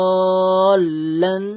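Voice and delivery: দলান held very long and loud into doubled l; joined lam. Shown as a demonstration. Teacher repeating the finished word, দলান long and joined. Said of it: "মিলে বললাম, যুক্ত করলাম".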